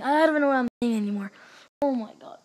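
usual speaking voice

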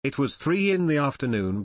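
usual speaking voice

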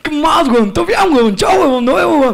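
high-pitched caricature of moneyed slang; mock rich kid. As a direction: play a rich kid greeting pals, voice pushed up. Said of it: "los que tienen dinero bastante tienen una palabrita". (caricatura de niño rico, en tono alto)

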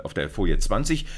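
normal talking voice

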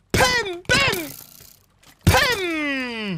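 very high, ringing "pam"; imitated hit noise. Real, not acted. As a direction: squeal out each hit, drawn out. Voices hits during play. (versi acuti di colpi)

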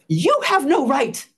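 loud, stern, slow finger-wagging rebuke; angry scolding stranger. Retold or imitated. Stranger scolds her over her post, loud and stern. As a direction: tell her off hard, word by word. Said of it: "And he says".

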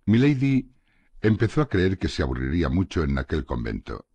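usual speaking voice

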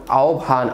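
আহ্বান said as "ao-bhan"; standard pronunciation. Shown as a demonstration. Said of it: "প্রমিত উচ্চারণ কোনটি".

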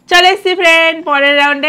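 loud, high show-host call; excited host announcement. Acted. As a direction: announce the round with gusto. Host announcing next round.

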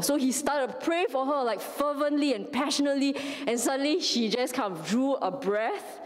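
normal talking voice

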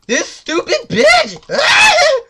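very high, loud, slow shriek; real screeching gamer rage; furious screaming. Real, not acted. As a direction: scream the curse, ending in a wordless yell. Attacked by enemy, screaming.

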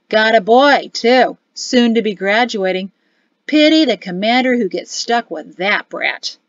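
brash soldier ranting to his mates; loud boisterous sergeant. Acted. Sergeant gossiping loudly.

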